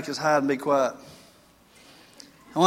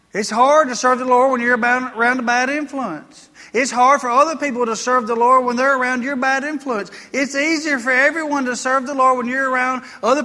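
raised, insistent sermon voice; preaching; 8 semitones higher, 4 dB louder than usual. Acted. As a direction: press the warning on the congregation. Preaching at full force.

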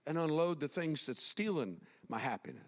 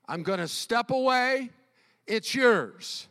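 raised, loud handing-over; emphatic acted prayer, voice lifted; declaring to God; 6 semitones higher, 9 dB louder than usual. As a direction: call it out high and firm.

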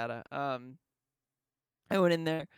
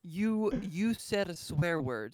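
a hint of a prim offended lady; 3 semitones higher, 7 dB louder than usual. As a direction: play it lightly prissy and scandalized. Mocking prim offended customer.

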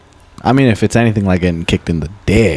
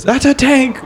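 much higher, announcer-style call-out; in-game announcer; slower than usual. Retold or imitated. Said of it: "the in-game announcer goes".

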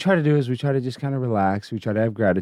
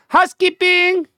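very high, loud put-on call; hotel maid call; 6 dB louder than usual. Calling out in a character voice.

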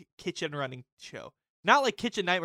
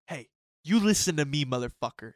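louder threatening chef impression; mock chef confrontation; 6 dB louder than usual. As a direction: get in his face with it. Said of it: "he goes".

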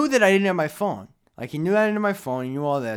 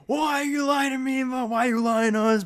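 mock screaming; 8 semitones higher than usual. Cops grilling two guys about lying, mock screaming. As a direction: imitate screaming cops without really yelling. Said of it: "They're screaming at us".

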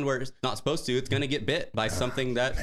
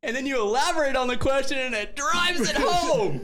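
laughing hard; 4 dB louder than usual. Real, not acted. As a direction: squeal it out while laughing. Laughing at friend's gaffe.

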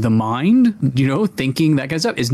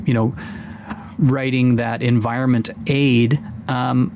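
ordinary talk, nothing marked

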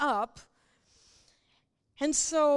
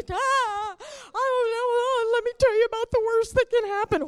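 high, loud, whining fright; a hammed-up panicky wail; mock fearful whine; 7 semitones higher, 6 dB louder than usual. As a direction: play the frightened believer bursting out. Acted fearful believer whining.

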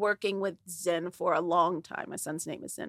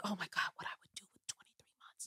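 quiet whisper; 7 dB quieter than usual. Retold or imitated. Friend marvels at the time left.

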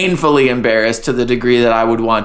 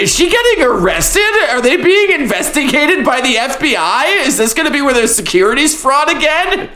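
high worried voice; 11 semitones higher than usual. Retold or imitated. Quote of own panicked thoughts.

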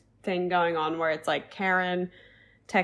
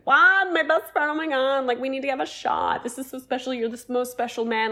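gushing best friend; 6 semitones higher than usual. Retold or imitated. Michael going up to the groom, mock gushing. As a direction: imitate an overexcited friend fawning.

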